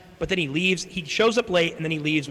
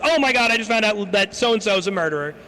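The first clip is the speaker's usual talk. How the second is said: higher, louder panicked report; imitated freak-out; 6 semitones higher, 5 dB louder than usual. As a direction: burst in with exaggerated alarm. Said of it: "she's having a freak out".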